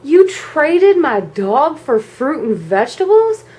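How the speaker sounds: high, incredulous retold protest; acted-out indignant astonishment; outraged disbelief. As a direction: stretch it out, rising in disbelief.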